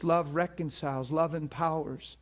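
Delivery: normal talking voice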